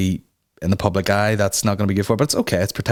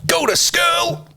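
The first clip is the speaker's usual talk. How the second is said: much higher, slow scolding yell; high mock yell; much slower than usual. To the kid in the clip, a high mock scolding yell. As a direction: squeal it at the wee torture.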